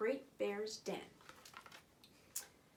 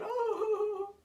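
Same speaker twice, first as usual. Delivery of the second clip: bear's whimper; 10 semitones higher, 6 dB louder than usual. Acted. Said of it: "he whimpers, and he moans".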